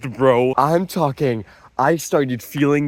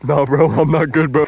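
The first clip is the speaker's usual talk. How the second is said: tweaking self; 3 semitones higher, 7 dB louder than usual. Retold or imitated. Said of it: "I probably was like".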